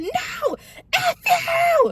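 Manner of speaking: very high, drawn-out retold scream; defiant shout. Angry driver refusing boyfriend's demand to slow down, high defiant shout.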